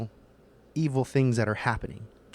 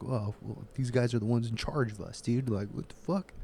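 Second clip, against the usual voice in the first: stunned quiet realization; 7 dB quieter than usual. Retold or imitated. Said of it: "go into your head of like".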